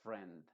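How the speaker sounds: "friend" said low as "frend"; correct friend. Shown as a demonstration. Teacher confirming the right "friend", said low. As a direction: land on "frend" low and firm. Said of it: "sino que se diría".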